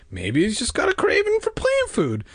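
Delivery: high-pitched joking brother; wisecracking. A narrator's reading. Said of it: "Casey cracked, still not taking her seriously".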